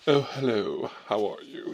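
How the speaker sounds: lightly puffed-up greeting, imitating him; mild mock pomp in the hello; pompous.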